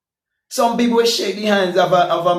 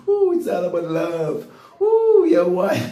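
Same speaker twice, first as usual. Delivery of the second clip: mocking quote.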